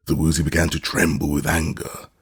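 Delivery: plain reading voice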